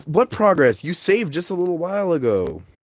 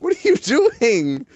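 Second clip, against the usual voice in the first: higher, slow, theatrical put-on voice; slow high hammed-up line; mock dramatic; 8 semitones higher, slower than usual. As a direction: ham it up like a stage villain's victim. Mock-dramatic reprise of the question.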